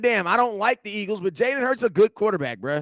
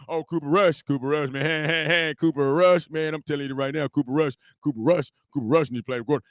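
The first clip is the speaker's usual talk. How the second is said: lower, mocking fan voice chanting Cooper Rush; mocking hyped fan; 6 semitones lower than usual. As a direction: ham up a fan hyping his backup. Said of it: "And then you coming over here".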